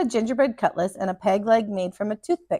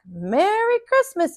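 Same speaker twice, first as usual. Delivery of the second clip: calling greeting; 9 semitones higher, slower than usual. A narrator's reading. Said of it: "he called".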